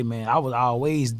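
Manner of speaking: ordinary talk, nothing marked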